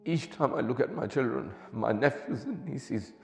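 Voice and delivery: high, keening lament over music; mourning sermon recitation. Acted. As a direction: chant the tragedy as a grieving preacher.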